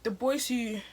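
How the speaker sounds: normal talking voice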